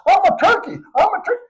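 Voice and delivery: high squeaky bird-like cries; squawking turkey boy. Acted. Boy discovering he's a turkey, high squawking cry.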